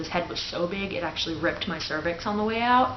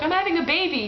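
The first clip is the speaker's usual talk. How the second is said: pitched-up giddy news; high excited announcement; 11 semitones higher, 5 dB louder, faster than usual. Retold or imitated. Daughter telling her dad she's in labour, high and giddy. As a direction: blurt out the big news brightly.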